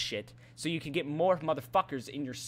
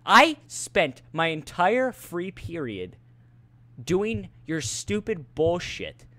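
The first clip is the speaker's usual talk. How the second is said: slow, emphatic anger; indignant rant; 6 dB louder, slower than usual. Real, not acted. Student venting at teacher over lost free period, slow indignation. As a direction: spell out the grievance slowly and forcefully.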